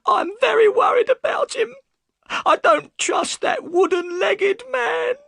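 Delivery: high, loud, crying cry of worry; tearful wife crying out her fear; sobbing shout. Acted. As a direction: wail it through tears. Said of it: "and burst into tears".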